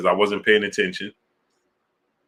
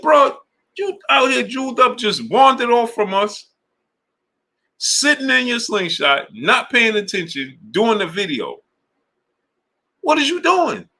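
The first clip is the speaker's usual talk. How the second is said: mimicking scolding friend; 13 semitones higher than usual. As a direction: squeal his boy's scolding rant. Friend scolding him, voiced in story.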